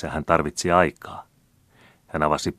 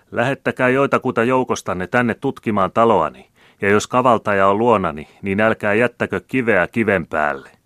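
higher, louder challenge; defiant oration; 5 semitones higher, 7 dB louder than usual. A narrator's reading. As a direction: dare the crowd to search. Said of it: "jatkoi puhuja".

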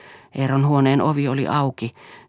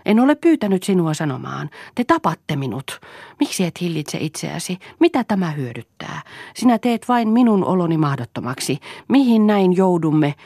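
higher, pressed reproach, as read in a story; upset mother voiced by a narrator; exasperated scolding; 5 semitones higher, 4 dB louder than usual. A narrator's reading. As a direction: scold in mounting despair, pitch raised. Mother scolds the boy, despairing.

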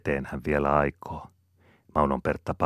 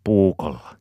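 louder, higher one-word threat; threatening; 5 semitones higher, 8 dB louder than usual. A narrator's reading. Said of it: "uhkaa Malakias".

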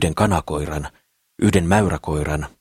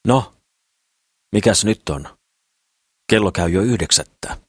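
put-on a sharp, raised snap from the old woman; grumpy old woman snapping through the door; 3 semitones higher than usual. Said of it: "raottaa sitä ja ärähtää".

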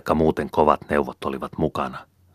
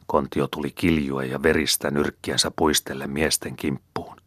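ordinary delivery

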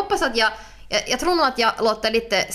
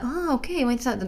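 high, light imitation of polite interest; a high "ah, okay", not pushed; mock mild interest; 5 semitones higher than usual. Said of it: "ingen sitter sådär och så här". (låtsat milt intresse, ljus röst)

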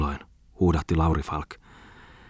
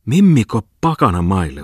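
alarmed cry, a bit louder; shocked exclamation; 2 semitones higher, 5 dB louder than usual. A narrator's reading. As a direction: blurt it out in alarm. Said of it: "huudahti Tommola".

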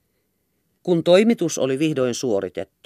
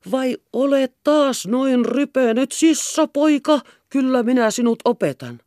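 scolding; 11 semitones higher, 3 dB louder than usual. A narrator's reading. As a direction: chase the boy down with a scolding. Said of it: "juoksi perässä ja torui".

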